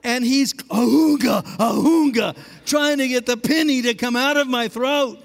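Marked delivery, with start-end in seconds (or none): 0.5-2.4 loud rhythmic heaving grunts; effort grunts. Retold or imitated. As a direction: grunt with each shake of the child. Dad shaking him by ankles.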